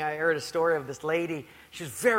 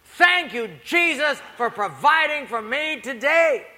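louder, higher thanks; loud grateful prayer; 6 semitones higher, 7 dB louder than usual. Retold or imitated. Lady praising Jesus loudly back at her neighbor. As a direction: lift it up over the heckling.

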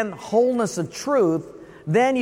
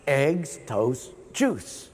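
lower, softer, halting repetition; slow learner repeating; 8 semitones lower, 5 dB quieter, much slower than usual. Retold or imitated. Student repeating the words back, lower and softer. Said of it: "He says".

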